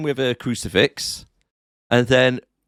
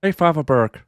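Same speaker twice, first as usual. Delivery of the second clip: put-on light Irish accent greeting; 4 semitones higher than usual. Joking about Father Burke, light Irish accent. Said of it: "not in an Irish accent".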